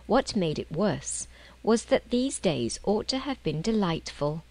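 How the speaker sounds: usual speaking voice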